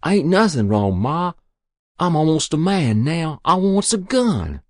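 put-on high, loud boyish insistence; insistent pleading. A narrator's reading. Boy demanding a gun from Ma, insistent. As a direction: insist you are grown up.

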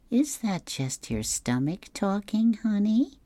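high, slow teasing wife; Blondie voice. Acted. Blondie teases Dagwood.

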